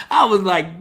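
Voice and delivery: ordinary talk, nothing marked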